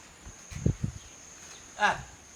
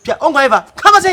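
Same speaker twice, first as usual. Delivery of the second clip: raised shout; 5 semitones higher, 7 dB louder than usual. Acted. Heated back-and-forth argument.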